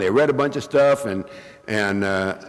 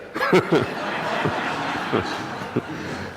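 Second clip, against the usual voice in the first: laughing; 5 dB quieter than usual. Real, not acted. Laughs at own joke.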